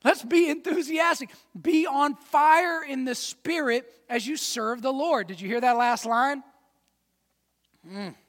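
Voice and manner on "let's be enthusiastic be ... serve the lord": louder and higher, building to a rallying cry; fired-up enthusiasm. Real, not acted. Preacher rousing a sleepy congregation to be enthusiastic. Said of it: "with a little bit of enthusiasm".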